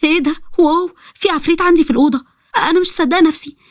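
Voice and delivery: put-on high-pitched astonished girl; high, awed disbelief; stunned amazement. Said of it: "بذهول".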